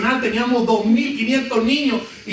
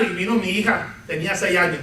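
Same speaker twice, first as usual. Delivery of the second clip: normal talking voice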